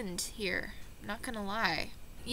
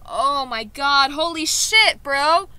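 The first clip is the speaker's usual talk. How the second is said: loud, higher, ecstatic yelling; mind blown; 4 semitones higher, 12 dB louder than usual. Real, not acted. Learner discovering Groot is Dutch for big, ecstatic shouting. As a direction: shout out the revelation as if your brain is exploding.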